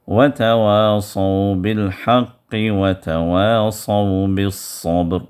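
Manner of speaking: drawn-out, measured tajweed chant; slow Quran recitation. Shown as a demonstration. Teacher reciting Surah Al-Asr for students, slow chant. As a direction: recite each word slowly and melodically.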